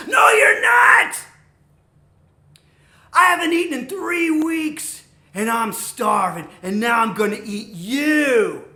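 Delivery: very loud, drawn-out roar; menacing troll. Acted. Starving troll threatening middle goat, roaring. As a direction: explode into a slow, high bellow.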